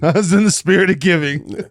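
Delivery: high, loud mimicking through laughter; laughing mockery. Co-host throwing his friend's excuse back at him, laughing mockery. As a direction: parrot it back loudly, cracking up.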